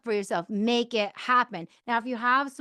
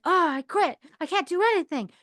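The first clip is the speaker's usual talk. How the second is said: frustrated giving up; 6 semitones higher, 4 dB louder than usual. Retold or imitated. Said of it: "then you'd be frustrated and you're like".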